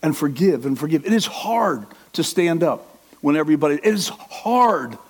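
normal talking voice

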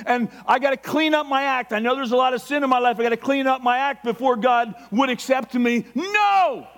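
loud, high, impassioned preaching; excited shouting sermon; fervent preaching shout. Real, not acted. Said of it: "My voice raises".

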